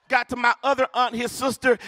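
ordinary talk

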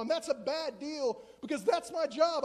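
normal talking voice

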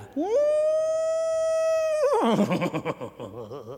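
éclat de rire très aigu et étrange. Acted. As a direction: rire perché, ravi de sa blague. Rit de son propre jeu de mots. (rire suraigu du poète amusé)

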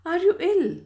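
high, loud, alarmed question; anxious. A narrator's reading. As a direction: press her with urgent worry. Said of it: "full of anxiety for her fragile-looking cousin".

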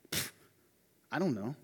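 "Pfft, I don't know" muttered low and soft, falling on "dont"; dismissive shrug. Retold or imitated. Preacher plays Cain's offhand reply to God, muttered low. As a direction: blow it off under your breath. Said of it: "All we have is".

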